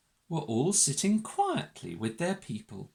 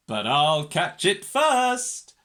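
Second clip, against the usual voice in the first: slow, loud competitive dog; third dog; 7 dB louder than usual. A narrator's reading. Third dog claims the chase, loud drawn-out boast. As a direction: push it out to outdo the others. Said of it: "said the third dog".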